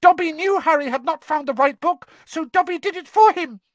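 high, loud elf squeak; squeaky house elf. Acted. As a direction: squeak it out urgently. Dobby voiced by narrator.

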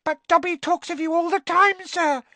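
very high squeaking voice; a house elf meeting someone famous; tiny elf squeak. Acted. Winky introducing herself to Harry, awed squeak. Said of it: "she said".

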